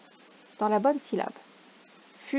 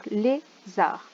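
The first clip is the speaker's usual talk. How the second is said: slow careful reading. Shown as a demonstration. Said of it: "word to sort by sound".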